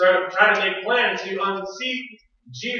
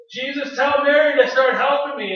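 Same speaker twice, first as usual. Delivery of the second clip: higher, louder acted grievance; acted complaint; 7 semitones higher, 5 dB louder than usual. Preacher as Martha complaining to Jesus, acted complaint. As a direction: demand that someone step in.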